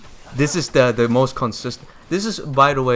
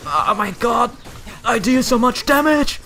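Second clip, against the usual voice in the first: excited bragging; 12 semitones higher, 4 dB louder than usual. Real, not acted. Bragging after big hit.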